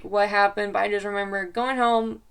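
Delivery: ordinary delivery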